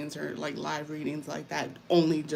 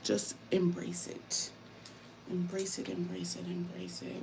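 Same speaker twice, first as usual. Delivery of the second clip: fading whisper; 7 dB quieter than usual. Real, not acted. Repeating mantra, fading.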